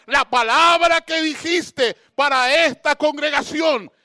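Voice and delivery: loud, high, fervent preaching; prédica a toda voz. Real, not acted. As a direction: exhort the congregation at full force. Preaching at full force. (prédica ferviente y exaltada a gritos)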